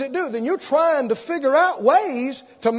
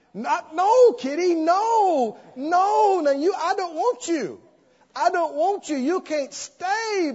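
higher, weakening protest to a cat; pleading with a kitty; 7 semitones higher than usual. Retold or imitated. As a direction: beg the cat to go while giving in.

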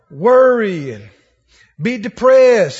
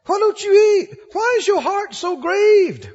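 tender husband; 8 semitones higher than usual. Retold or imitated. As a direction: gently coax a grieving wife to eat. Elkanah voiced again.

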